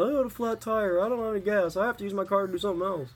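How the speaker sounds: high whiny mimic of a flaky friend; mock her lame excuses in a whine.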